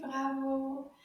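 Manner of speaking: ordinary talk, nothing marked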